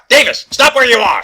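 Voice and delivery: high, very loud halt command; shouted order. Acted. Detective stopping someone fleeing, shouting. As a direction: bellow to freeze a suspect.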